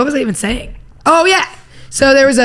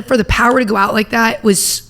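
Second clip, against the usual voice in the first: usual speaking voice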